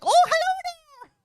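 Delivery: high, loud sing-song greeting; imitating her mom. Wife imitating husband imitating her mother, high and loud. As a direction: squeak it up high like a sweet mom. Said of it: "Ryan wakes up and he's like".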